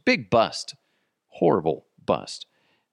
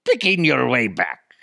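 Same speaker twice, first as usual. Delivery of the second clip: hammy pirate reading a treasure poem; slower than usual. Pretend. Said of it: "if I read it as a pirate".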